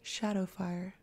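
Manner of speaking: soft, silken breathy word; breathed. Acted. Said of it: "a silken female voice breathed".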